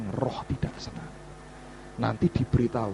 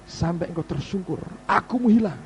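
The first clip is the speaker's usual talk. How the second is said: ordinary talk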